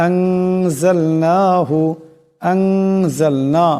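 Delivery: আনজালনাহু with the final না stretched long and slow; lengthened madd. Shown as a demonstration. Teacher showing students the long madd in আনজালনাহু. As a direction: draw out the না in আনজালনাহু. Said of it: "এই নুনকে লম্বা করিয়া পড়তে হবে".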